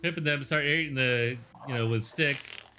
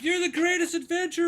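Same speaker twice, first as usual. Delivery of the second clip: put-on high, loud adoring kid voice; Pip; 6 dB louder than usual. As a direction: gush to your hero in a squeaky shout. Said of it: "says Pip".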